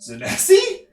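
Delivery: high, surprised call of a name. Acted. GM voices NPC calling her name.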